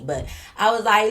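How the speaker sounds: ordinary talk, nothing marked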